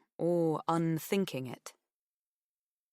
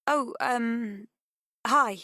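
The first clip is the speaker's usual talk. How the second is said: higher, caught-off-guard phone greeting; hesitate, then recover into a louder hi; flustered young caller, pitched up; flustered recovery; 6 semitones higher, 4 dB louder, much slower than usual. A narrator's reading. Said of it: "recovering, clearing her throat".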